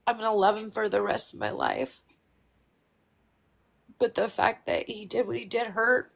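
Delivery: holding it together while grieving; getting emotional. Real, not acted. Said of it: "I don't know why I'm getting emotional".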